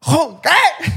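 high, incredulous shout; bartender confundido. Retold or imitated. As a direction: call it out high, not understanding. Acts confused bartender mishearing the order. (camarero confundido, grito agudo)

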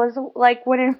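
usual speaking voice

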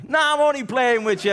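high, jovial Irish backtrack; Irish heckler. Retold or imitated. Irish heckler pretending it was a joke, loud Irish joviality. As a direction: laugh it off high-pitched in an Irish accent. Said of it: "this Irish guy".

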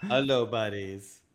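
my slow reply. Retold or imitated. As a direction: answer the clerks slowly and low. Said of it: "and I'm like".

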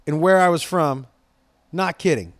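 ordinary talk

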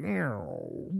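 soft, low mimicked gas rumble; small drawn-out gurgly whine; imitated stomach gurgle. Describing gas moving in the stomach, imitated gurgle. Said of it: "my stomach, it's like".

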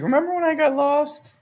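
put-on very high-pitched child voice; little daughter. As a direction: squeak it like a little kid asking. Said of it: "Aaliyah brings it up".

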